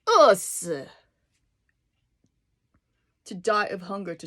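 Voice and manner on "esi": "Esi" said loud and slowly falling; Chinese word. Shown as a demonstration.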